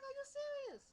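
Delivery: very high-pitched disbelief; retold incredulity. Vlogger retelling her reaction to the prank, high incredulous squeal. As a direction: squeal the question in mock shock.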